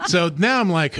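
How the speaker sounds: ordinary delivery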